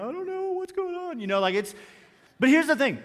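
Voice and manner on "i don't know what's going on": mock tearful. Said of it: "I'm tearing up".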